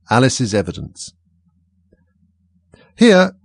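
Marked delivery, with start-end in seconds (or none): 3.0-3.3 high, loud startled answer; crying out. A narrator's reading. Alice answering in the flurry of the moment, loud high cry. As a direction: cry it out, pitched right up. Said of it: "cried Alice".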